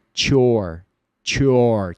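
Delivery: "Chore" said as one sound, TS and your fused into a "tch"; linked "what's your". Shown as a demonstration. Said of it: "fique esse som aqui". ("chore" com som de tch)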